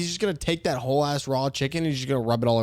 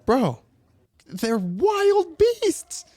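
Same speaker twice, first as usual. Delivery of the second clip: high, slow and louder; exclaiming; 14 semitones higher, 5 dB louder, much slower than usual. Real, not acted. Telling his friend about the fox, high and drawn out. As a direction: stretch it out in disbelief.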